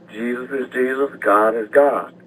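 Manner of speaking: ordinary talk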